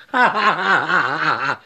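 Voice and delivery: short rhythmic laugh; rattling ha-ha laugh; staccato cackle. Real, not acted. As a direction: laugh in rapid separate ha-has. Laughs again after nose scratch remark.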